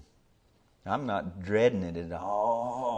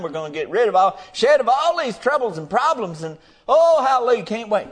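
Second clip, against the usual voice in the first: much higher, louder hallelujah cry; joyful shout; 10 semitones higher, 8 dB louder than usual. Acted. Preacher crying hallelujah to the congregation, high and loud. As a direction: shout it in church joy.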